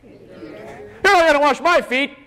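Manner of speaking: high, drawn-out protest; indignant complaint. Acted. Peter at the Last Supper, complaining indignantly. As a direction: balk at it, high and slow.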